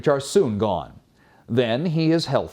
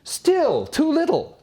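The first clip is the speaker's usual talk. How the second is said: much higher, slow and petulant; high, drawn-out grumbling protest; greedy complaint. Acted. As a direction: whine like a spoiled bargainer. Man haggles with God.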